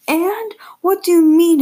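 high, loud outraged shout; angry dad shouting. Acted. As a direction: shout at the child in disbelief.

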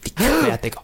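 high, dramatic "Die Querdenker"; dramatic mock horror. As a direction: ham up the horror. Repeats phrase dramatically.

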